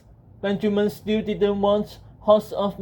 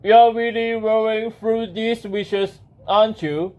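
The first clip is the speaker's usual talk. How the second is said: genie; 3 dB louder than usual. Acted. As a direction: take your time, teasing the wisher. Genie remarks on many wishes.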